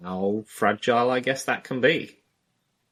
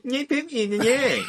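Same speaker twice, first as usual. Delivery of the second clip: high, laughing mockery of the complaint; mocking repeat; 14 semitones higher than usual. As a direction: parrot him back like a schoolyard taunt. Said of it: "just mockingly repeat what they say".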